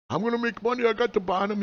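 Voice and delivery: high-pitched mock newbie boast; mock eager noob. Mocking new traders bragging, high and cocky.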